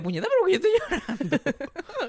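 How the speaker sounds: put-on high, whiny child's voice; crying kid. His younger self crying, in a high whiny voice. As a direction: whimper it like a weepy little boy. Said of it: "Que yo mismo decía".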